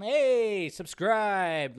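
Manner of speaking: loud, high hail; calling out. Real, not acted. Streamer hailing a new subscriber, loud drawn-out call. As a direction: holler a cheer for the new subscriber.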